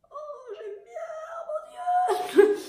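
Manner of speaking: very high, stretched delighted wail; hammed-up rapture; ecstatic cry. Real, not acted. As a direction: swoon over the colour in a drawn-out high cry. Delighted over a lipstick.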